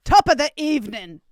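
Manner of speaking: mock Irish accent. Cohost fixing the botched greeting, mock Irish accent.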